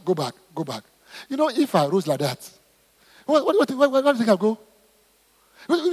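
ordinary talk

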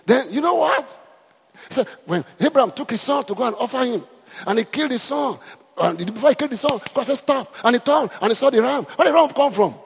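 high and loud preaching; imitating a shouting preacher; preacher's shout. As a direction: press the question like a fiery preacher. Preacher voiced in story.

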